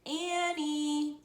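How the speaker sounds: loud, drawn-out, sustained call; high holler of a name. A narrator's reading. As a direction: shout for someone out of sight. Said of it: "he called".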